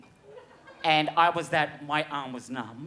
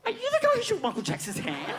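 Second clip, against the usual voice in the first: much higher voice of an eager usher; imitating usher; faster than usual. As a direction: ask it with starstruck eagerness. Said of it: "she goes".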